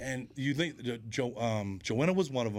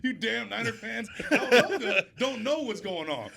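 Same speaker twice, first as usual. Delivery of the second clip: laughing retelling; 11 semitones higher, 4 dB louder, slower than usual. Retold or imitated. Laughing while retelling the scene.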